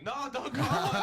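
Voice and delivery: loud, high-pitched mock panic; laughing, hammed-up frantic plea; panicked protest. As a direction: blurt it out in exaggerated alarm. Panicked protest in retold exchange.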